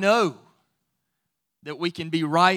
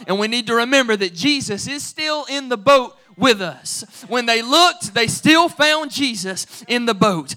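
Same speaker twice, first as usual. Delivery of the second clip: louder, higher, rising preacher fervor; full-force preaching; 4 semitones higher, 7 dB louder than usual. Acted. Pastor assuring the church Jesus is in the boat, fervent preaching. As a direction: build it up to the congregation.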